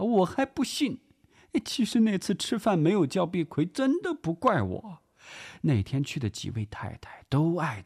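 high-pitched theatrical whine; put-on tearful, wronged lady; aggrieved, near tears. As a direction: plead your innocence as if about to cry. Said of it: "委屈的要哭的腔调".

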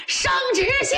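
running shout. A narrator's reading. General runs back with the imperial edict, shouting it out. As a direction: call it far ahead while running. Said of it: "一边跑一边喊".

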